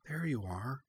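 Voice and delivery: tender hushed welcome; softly. A narrator's reading. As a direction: murmur it as you hug the pup. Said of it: "said Cora softly".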